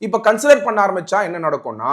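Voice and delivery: ordinary delivery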